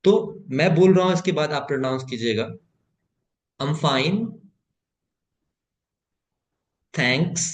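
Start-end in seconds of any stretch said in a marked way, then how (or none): 3.6-7.5 long pauses between each word of "I'm fine, thanks, and what about you". Shown as a demonstration. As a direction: model slowly, one word at a time. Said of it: "मैं एक बार पूरा pronounce करूंगा".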